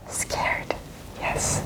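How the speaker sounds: voiceless hushed scared; whisper. A narrator's reading. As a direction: breathe it out frightened. Whispered answer.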